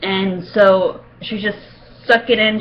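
usual speaking voice

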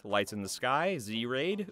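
ordinary delivery

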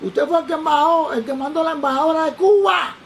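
put-on high, booming drunken accost; imitated shouting. Drunk stranger accosting the secret visitor, imitated shouting. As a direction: bellow the question, stretching the last word. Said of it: "gritaba, digo".

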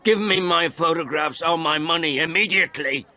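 high, loud, insistent yell; furious ultimatum, pitched high; shouted demand. A narrator's reading. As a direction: demand it at the top of your temper. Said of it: "I shouted".

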